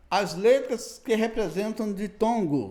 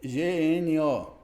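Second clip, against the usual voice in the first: G N O spelled letter by letter, slow; G N O dictated slowly to students; much slower than usual. Shown as a demonstration. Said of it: "a palavra gnomo".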